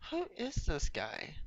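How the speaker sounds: high, slow mock indignation; retold angry disbelief. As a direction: draw out the question in acted disbelief. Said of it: "I was just super angry".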